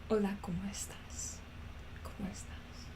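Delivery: unvoiced whisper trying out Spanish; whispered Spanish practice. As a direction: whisper it to test the sound.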